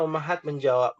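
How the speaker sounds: ordinary talk, nothing marked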